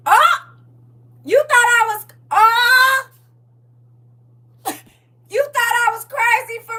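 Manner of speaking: high, loud sassy quote; high mocking voice. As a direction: squeal it mockingly. Mocking the viewer's reply.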